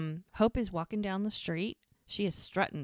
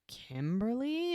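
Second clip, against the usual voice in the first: incredulous; 6 semitones higher, slower than usual. Retold or imitated. Sister names husband's new date.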